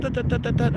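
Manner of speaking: high, soft tut tut clicks; clicking sound. Retold or imitated. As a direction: click your tongue like tapping a button. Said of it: "just took five seconds and went".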